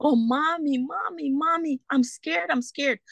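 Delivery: high-pitched imitation of a frightened child; scared child. Mother retelling her child's cries for mommy, imitated scared child. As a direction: plead like a child calling for mother. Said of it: "hear a child screaming every time".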